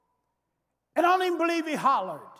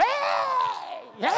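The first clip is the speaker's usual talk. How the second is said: celebratory shout; 12 semitones higher than usual. Retold or imitated. Preacher imitating the church shouting, a high joyful whoop.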